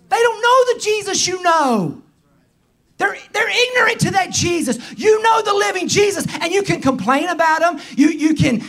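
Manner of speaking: high, urgent preacher's voice; impassioned preaching. Real, not acted. Preacher explaining unbelief to older members, impassioned preaching. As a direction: drive the point home fervently from the pulpit.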